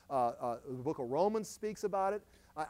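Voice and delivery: ordinary talk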